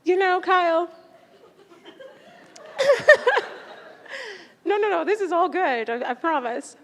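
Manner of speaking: high, loud voice, half laughing, half crying; laughing through tears. Real, not acted. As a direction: laugh it off while holding back tears. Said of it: "I'm just trying not to cry".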